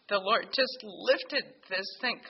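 normal talking voice

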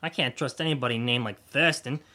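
normal talking voice

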